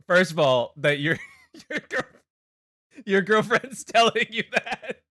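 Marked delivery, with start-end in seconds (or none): 3.0-5.0 laughing disbelief. Real, not acted. Laughing at chat message.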